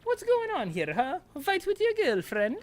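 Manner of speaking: put-on high, sneering ghost girl; high mocking ghost voices. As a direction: tease in a high bratty voice. Reads ghost characters' lines.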